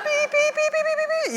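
very high, rapid monitor beeps; heart monitor beeping. Retold or imitated. Heart rate climbing on the screen, high urgent beeping. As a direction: imitate a racing heart monitor. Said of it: "my heart rate going up on the screen".

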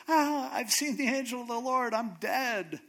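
high-pitched cry of dread; mock panic. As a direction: wail it like a hammed-up doomed man. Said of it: "now he's afraid because he goes".

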